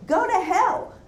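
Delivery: exclaimed, pitch leaping up; crying out. Acted. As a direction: cry it out like an angry curse mid-poem. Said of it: "Cry out from a giant lobster pot".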